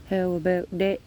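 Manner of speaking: dog praise voice. Real, not acted. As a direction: lift the whole question up for the dog. Asking the dog again.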